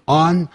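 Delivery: "On" like 安 with rounder lips; slow, drawn-out "On" with n ending; correct rounded "on". Shown as a demonstration. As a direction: round the mouth on "On", end in a clean n. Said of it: "念成安全的安， 但嘴巴圆一点".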